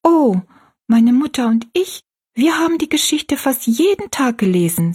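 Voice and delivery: put-on high-pitched, earnest little girl; girl's voice. A narrator's reading. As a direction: tell it as a young girl. Narrator voices young Rosalie.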